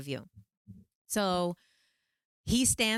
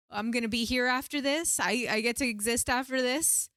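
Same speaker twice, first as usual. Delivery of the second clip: higher, tentative checking; hopeful disbelief; 6 semitones higher than usual. Retold or imitated. Checking with the helper that she will exist, hopeful disbelief. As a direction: ask it in acted amazement. Retold fragile relief at reassurance.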